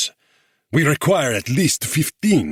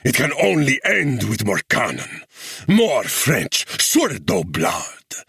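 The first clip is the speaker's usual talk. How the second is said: high, loud, slow furious tirade; thundering rage; 3 semitones higher, 3 dB louder, slower than usual. A narrator's reading. Guerrilla chief rages at the English officer, thundering. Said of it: "he thundered".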